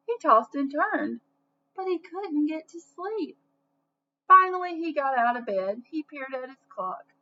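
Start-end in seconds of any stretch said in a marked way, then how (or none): 4.3-4.8 high, loud "Finally,"; big storyteller's finally; emphatic finally. A narrator's reading. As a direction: burst out with "Finally,". Dramatic story beat.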